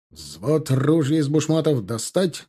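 ordinary delivery, nothing marked

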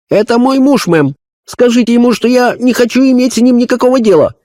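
high, indignant woman's voice; flushed and offended, refusing; angry little woman. Acted. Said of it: "раскрасневшаяся от гнева особа". (высоко и возмущённо, сердитая женщина)

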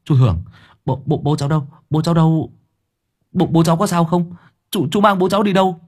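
put-on boy begging the uncle where his father is; panicked boy.

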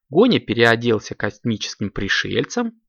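usual speaking voice

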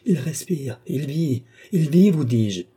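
high, insistent cry, growing loud; excited exclamation. A narrator's reading. Philippe feeling a heartbeat, exclaiming excitedly. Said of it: "s'écria Philippe".

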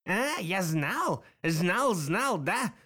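put-on slow, high, rattly old madman; rattling old man voice. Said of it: "послышался дребезжащий голос".

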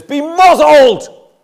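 high, loud, slow rebuke to demons; forceful command. Shown as a demonstration. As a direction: bark it at a demonic power. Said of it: "The other word is far stronger".

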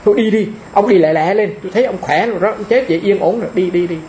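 lightly voiced old monk waving his father off; brisk dismissal. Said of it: "mà tôi còn nói".

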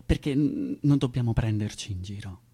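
ordinary delivery

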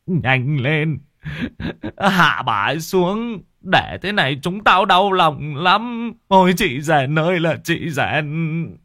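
high, slow tearful command; old man ordering the young men to take down the body; grieving order. Acted. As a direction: bark orders through grief. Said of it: "rồi quát mấy thằng thanh niên".

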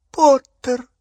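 high, loud squeal; sudden piercing yelp; shriek. A narrator's reading. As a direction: shriek it out in shock. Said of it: "взвизгнула Беллатриса".

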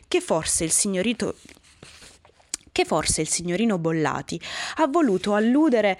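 plain reading voice

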